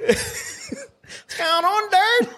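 high, very slow snorkel-muffled voice breaking into laughter; Snorkel impression. Mate imitating Snorkel greeting a workmate, slow and high. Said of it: "I used to love seeing him".

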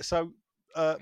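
ordinary talk

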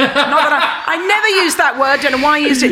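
high, loud, laughing apology; giggling as the word slips out; laughing backtrack. Real, not acted. Guest laughs, apologizing to the hosts for the word.

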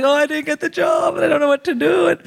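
high, hammed-up wailing; manic crying. Daughter phoning parents after job rejection, manic crying. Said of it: "full Indian Bollywood manic crying".

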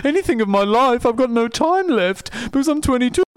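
high, loud mocking whine of a young man; mocking whiny self. Teasing friend mocks young me, high whiny voice. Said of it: "for saying".

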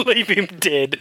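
laughing through the words, slow and high; giggling echo of a mate's threat. Real, not acted. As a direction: repeat it while cracking up. Laughing echo of friend's line.

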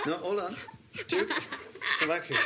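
high playful teddy voice; talking plush toy. Acted. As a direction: voice the teddy, laughing. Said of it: "not the Teddy voice".

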